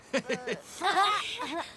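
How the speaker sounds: short embarrassed laugh, high; rire gêné. Acted. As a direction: laugh off his rustiness. Admits he's rusty, laughs. (petit rire gêné et aigu)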